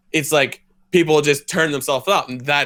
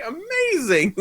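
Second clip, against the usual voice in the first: high, sing-out fake delight; mock amazement; slower than usual. Acting out someone who learns yelling gets their way, mock amazed. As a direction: exclaim it high as if thrilled.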